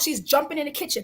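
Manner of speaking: ordinary talk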